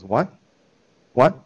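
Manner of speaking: ordinary delivery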